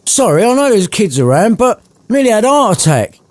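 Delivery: high, startled outburst; father recovering from his daughter's jump scare; shocked exclamation. Acted. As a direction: gasp it out after a fright. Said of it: "exclaimed Bob".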